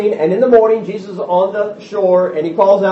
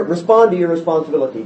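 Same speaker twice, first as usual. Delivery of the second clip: usual speaking voice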